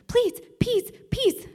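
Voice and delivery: put-on high, loud little-girl squeal of please; toddler demanding. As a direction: mimic a toddler insisting loudly. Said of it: "she would say".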